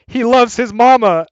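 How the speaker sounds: a movie character's dopey declaration of love; imitating Waterboy. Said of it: "signs that say".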